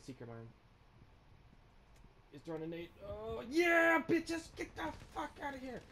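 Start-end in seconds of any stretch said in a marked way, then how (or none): 3.1-5.8 very high victory shout; triumphant yell. Real, not acted. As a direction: whoop over the kill. Celebrating kill.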